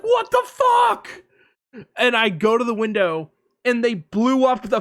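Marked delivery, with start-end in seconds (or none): 0.0-1.1 very high, slow, loud scream; terrified shout. Retold or imitated. Hitting the deck at the blast, high panicked scream. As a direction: scream as if the world ends. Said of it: "I was like".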